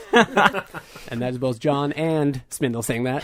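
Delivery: normal talking voice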